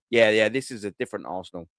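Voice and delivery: low, quiet, fast mock quote; mock rival. Imitating City players, quick, low and quiet. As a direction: rattle off a mock rival's shrug. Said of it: "they'll go".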